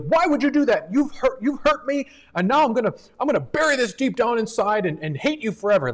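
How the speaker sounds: loud, high-pitched mock tantrum; screaming child's protest. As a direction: yell it accusingly, like an imagined furious kid. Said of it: "our screaming children".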